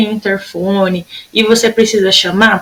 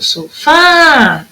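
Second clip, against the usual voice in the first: "sofá" called out with a long final fá; chamando, FÁ esticado; 5 semitones higher than usual. Shown as a demonstration. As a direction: hold the fá of "sofá" like a call. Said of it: "Sempre vai chamar a palavrinha". ("sofá" chamado com o fá bem comprido)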